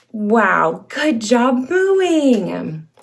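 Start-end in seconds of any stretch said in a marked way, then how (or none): none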